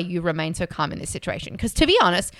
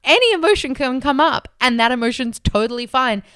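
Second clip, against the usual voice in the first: higher, louder, airy relieved laugh-talk; breezy and freed; light relief; 5 semitones higher, 5 dB louder than usual. Retold or imitated. Person feeling liberated from anger, bright relief. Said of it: "it's like".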